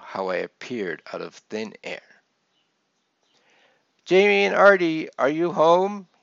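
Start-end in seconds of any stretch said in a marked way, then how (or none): 4.0-6.1 higher, louder motherly call; raised calling voice from another room; yelled from afar. A narrator's reading. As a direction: call through the house to the kids. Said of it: "yelled my mum from another part".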